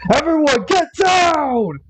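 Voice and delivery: high, urgent command to take cover; commanding shout. Acted. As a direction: bark the order to get down. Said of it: "with his commanding voice".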